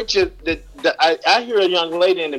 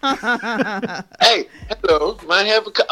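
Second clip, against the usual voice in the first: put-on higher-pitched go at an English accent; attempted English accent; 5 semitones higher, slower than usual. American guest copying British host, mock English accent. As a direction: imitate the host's English accent, playfully. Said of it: "learn you guys' accent".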